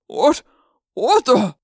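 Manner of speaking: a man caught off guard; startled. Acted. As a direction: trainer shocked as the elephant lunges, startled. Startled trainer grabbed by elephant.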